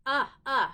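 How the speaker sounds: short loud "uh" twice; uh sound. Shown as a demonstration. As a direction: punch out "Uh, uh" as a vowel sound. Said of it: "What makes the uh sound".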